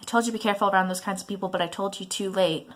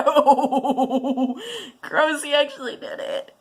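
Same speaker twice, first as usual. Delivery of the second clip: high, giggling ew; laughing disgust; 6 semitones higher, 4 dB louder, much slower than usual. Real, not acted. Son laughing as dad eats the sauce. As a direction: laugh through the gross-out.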